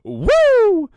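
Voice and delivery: high, loud, piercing Ric Flair woo. Retold or imitated. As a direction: belt it out like Flair on the top rope. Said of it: "and he goes".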